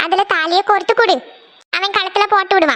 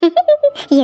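short high giggle; amused giggle; 9 semitones higher than usual. Acted. Bride amused at another wedding plan, giggling. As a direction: giggle at the absurd idea. Amused by talk of marrying again.

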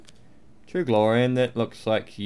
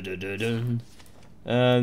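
usual speaking voice

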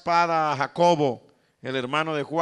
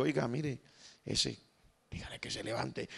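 hushed inner voice; 3 semitones lower, 13 dB quieter than usual. Acted. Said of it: "y el Espíritu Santo le dijo".

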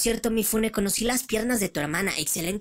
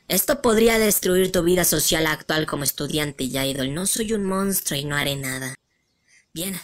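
ordinary talk, nothing marked

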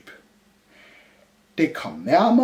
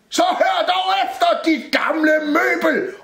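very high, loud scolding; angry shout; 5 dB louder than usual. A narrator's reading. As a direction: yell at a stubborn old thing. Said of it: "råbte Rumle". (råber vredt)

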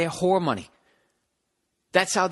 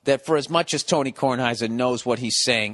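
usual speaking voice

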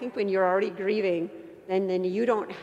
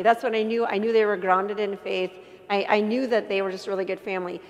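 ordinary talk, nothing marked

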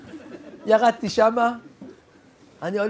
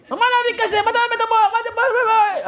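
much higher, louder disbelieving outburst; incredulous father; 4 dB louder than usual. Retold or imitated. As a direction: sputter in disbelief at the offer.

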